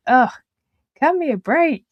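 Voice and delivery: exasperated, lightly acted plea; mock exasperation. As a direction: act out being fed up. Voices frustration on bad days.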